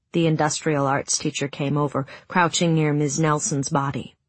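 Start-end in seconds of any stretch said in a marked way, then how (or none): none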